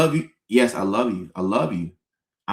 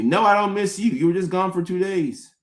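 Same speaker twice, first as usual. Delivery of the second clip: higher, blunt mock reply; blunt honest answer; 6 semitones higher than usual. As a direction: answer flatly that you didn't miss them.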